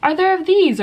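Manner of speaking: high sing-out classroom question; high-pitched kindergarten teacher; teacher asking class. Shown as a demonstration. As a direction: quiz small children in a high voice. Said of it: "just by asking".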